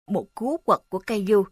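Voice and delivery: plain reading voice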